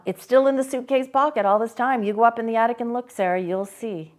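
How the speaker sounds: Johnny insisting on what he sees, pressing on; Johnny certain. A narrator's reading.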